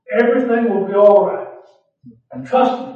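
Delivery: loud, proclaiming the reassurance; ringing promise. Shown as a demonstration. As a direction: lift it to the back row. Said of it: "I'm gonna say it now".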